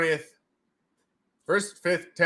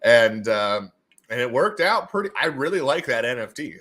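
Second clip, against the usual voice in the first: ordinary delivery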